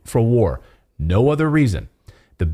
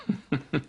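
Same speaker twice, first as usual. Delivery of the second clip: quick soft laugh of disbelief; a brief derisive chuckle; short scoffing laugh; 2 semitones higher, 9 dB quieter than usual. Real, not acted. Laughs after the claim.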